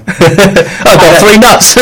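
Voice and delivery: high, very slow, laughing; laughing, stretched-out gag. Real, not acted. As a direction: drag it out while cracking up. Laughing mock line.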